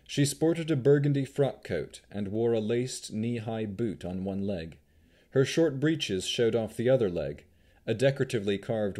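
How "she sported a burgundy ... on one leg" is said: usual speaking voice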